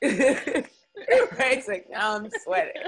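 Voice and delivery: a teary laugh, words breaking up; laughing while crying. Real, not acted. Host jokes about sweating, laughing through tears. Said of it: "I'm still crying".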